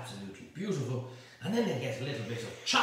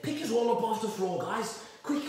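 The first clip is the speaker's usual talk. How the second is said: higher, brisk mock-posh order; spiffingly posh; 6 semitones higher, 3 dB louder than usual. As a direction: bark it like a jolly English toff. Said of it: "rather spiffingly".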